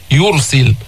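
يرسل with a full, thick ر; weight the ر of يرسل; short demo of يرسل, dark "yur"; heavy ra. Shown as a demonstration. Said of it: "تفخم الراء".

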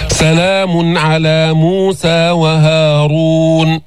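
very slow chant with a full, dark ر in وهارون; sustained recitation, emphatic ر in وهارون; heavy ra. Shown as a demonstration. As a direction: recite وهارون with a thick r and rounded long u. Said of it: "يعني مفخمة".

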